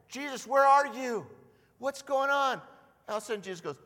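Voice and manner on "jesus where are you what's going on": higher, louder worried calling; mock-distressed cry; anxious plea. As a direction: call out for someone lost. Disciples crying out for Jesus.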